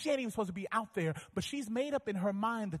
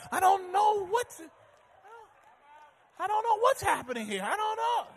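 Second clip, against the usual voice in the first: panicked husband; 13 semitones higher, 5 dB louder than usual. Retold or imitated. Panicked man in feminine aisle.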